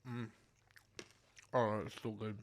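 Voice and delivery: talking through a mouthful of food; chewing while speaking, mouth full. Real, not acted. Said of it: "Excuse me for speaking with my mouth full".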